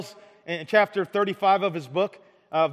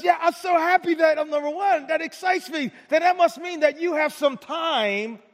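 delighted Jesus; 7 semitones higher than usual. Retold or imitated. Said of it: "And Jesus says".

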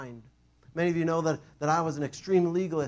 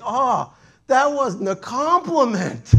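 much higher, slow, loud dawning shock; sudden realization; 9 semitones higher, 12 dB louder, much slower than usual. Retold or imitated. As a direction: let it hit you late, slowly. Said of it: "all of a sudden I was like".

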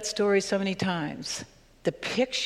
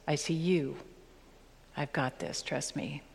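tender voice of God; 3 semitones lower, 8 dB quieter than usual. Acted. God's voice, tender reassurance.

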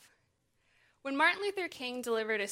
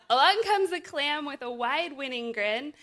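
laughing into the read-aloud; lively picture-book narration; storybook reading; 2 semitones higher, 5 dB louder than usual. Acted.

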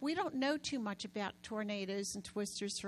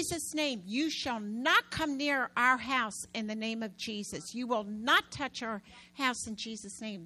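louder, forceful command to the twister; commanding prayer; 7 dB louder than usual. Retold or imitated. Wife praying against the tornado, loud and commanding. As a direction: rebuke the storm with authority. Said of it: "That's how I prayed".

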